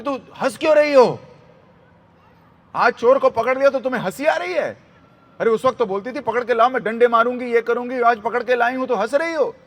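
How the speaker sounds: high, loud indignant protest; acted outrage at being laughed at; भड़की गोपी. हंसती यशोदा पर गोपी भड़की. (ऊँची, तेज़ आवाज़ में नाराज़ शिकायत)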